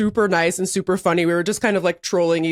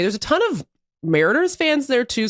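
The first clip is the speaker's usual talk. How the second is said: ordinary delivery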